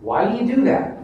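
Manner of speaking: ordinary talk, nothing marked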